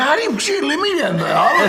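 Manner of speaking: high, loud laughing exclamation; excited disbelief. Retold or imitated. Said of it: "I said".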